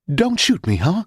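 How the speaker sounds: high-pitched frightened plea; high, feeble begging, trailing into a question; winded plea. A narrator's reading. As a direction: plead with too little wind for a statement. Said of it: "not enough wind in him".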